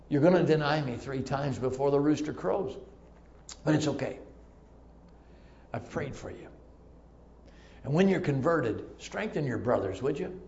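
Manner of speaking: low, soft, forgiving tone; quiet, tender acted forgiveness; gentle reassurance. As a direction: comfort a friend who will fail you. Jesus acted reassuring Peter.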